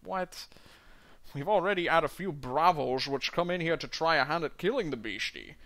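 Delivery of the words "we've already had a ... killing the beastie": Scottish burr, rolled and heavy, dwarf in a tavern; a Scottish dwarf doing his best Connery; Sean Connery impression. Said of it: "do a Sean Connery impression".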